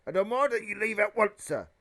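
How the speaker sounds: loud, high, outraged order; indignant demand. Acted. Uncle Vernon ordering the giant to leave, indignant. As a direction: order the intruder out at full voice.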